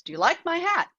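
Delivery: high-pitched storybook dog question. Retold or imitated. One dog asks another about its hat, high storybook question. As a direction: ask it high, as in a picture book. Acted dog's question in retold book.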